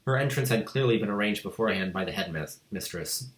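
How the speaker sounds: plain reading voice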